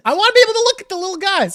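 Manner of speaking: high, fast and loud gush. Real, not acted. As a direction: squeal over the cute little emotes. Gushing over emotes.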